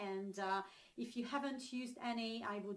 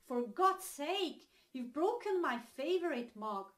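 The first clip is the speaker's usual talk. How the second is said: acted annoyed outburst, higher and louder; angry; 4 semitones higher, 5 dB louder than usual. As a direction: scold a flatmate in mock exasperation. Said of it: "then you're really angry".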